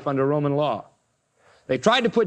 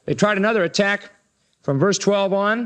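ordinary delivery

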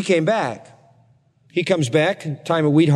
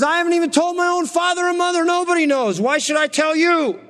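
much higher, fed-up mock retort; exasperated Samson; 13 semitones higher than usual. Samson snapping back at his nagging wife, exasperated.

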